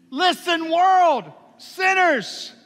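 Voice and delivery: high, loud summons to the whole world; booming call. Acted. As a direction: call out to every sinner at once. God calls to the world.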